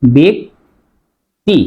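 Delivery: ব্যক্তি split slowly into "bek... ti"; slow model "bek, ti" for ব্যক্তি; syllable by syllable. Shown as a demonstration. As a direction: draw out ব্যক্তি with a long pause before "ti". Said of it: "উচ্চারণ হবে".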